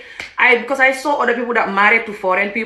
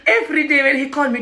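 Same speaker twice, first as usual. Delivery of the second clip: ordinary talk, nothing marked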